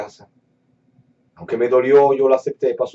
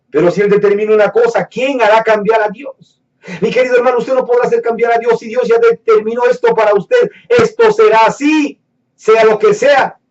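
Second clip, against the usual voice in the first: higher, firm preacher's declaration, slowing at the end; preaching; 9 semitones higher, 5 dB louder than usual. Acted. Preacher telling a brother God's will stands, firm. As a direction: pronounce it as settled law.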